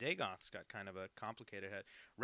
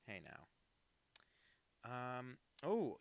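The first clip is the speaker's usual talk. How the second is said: ordinary delivery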